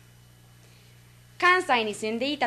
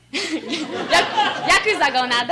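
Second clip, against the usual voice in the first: louder, breaking up on the words; laughing; 5 semitones higher, 5 dB louder, slower than usual. Real, not acted. Student listing dialects to learn, laughing at the last one. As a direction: push the punchline out through laughter. Laughs with audience at own joke.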